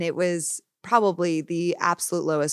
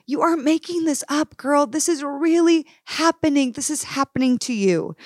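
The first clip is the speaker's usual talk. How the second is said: urgent self-insistence; 8 semitones higher, 4 dB louder than usual. Retold or imitated. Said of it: "I remember saying".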